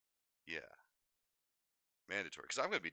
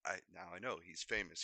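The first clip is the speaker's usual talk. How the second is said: usual speaking voice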